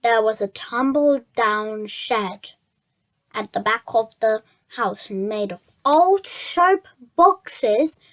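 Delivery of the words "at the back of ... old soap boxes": ordinary delivery, nothing marked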